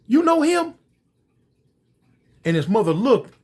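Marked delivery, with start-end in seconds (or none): 0.0-0.8 shocked. Acted. Son confronts mother in car.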